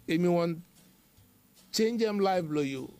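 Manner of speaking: ordinary talk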